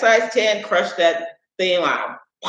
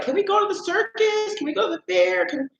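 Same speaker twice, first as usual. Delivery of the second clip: high eager wheedling voice, a touch softer; mock-childish begging to go to the fair; dad's eager question; 14 semitones higher, 5 dB quieter than usual. Dad spotting fair lights, asking in a high eager voice. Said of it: "my dad would be like".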